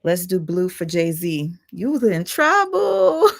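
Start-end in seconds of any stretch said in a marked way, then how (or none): 1.7-3.3 mock crying. Mock crying about [name].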